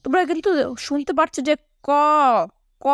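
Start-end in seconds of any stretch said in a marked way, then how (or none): 1.8-2.9 "ক" held long on its open o vowel; audible final vowel. Shown as a demonstration. Teacher letting pupils hear the vowel inside "ক", drawn-out vowel. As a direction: stretch "ক" so the closing অ rings out. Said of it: "শেষে কিন্তু অ".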